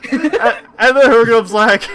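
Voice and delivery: laughing through the words; breaking up with laughter. Real, not acted.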